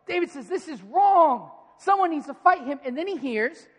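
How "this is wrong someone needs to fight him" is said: louder, higher, slow and outraged; indignant. Retold or imitated. David rebuking the frightened soldiers, loud indignation. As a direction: plant your feet and demand justice.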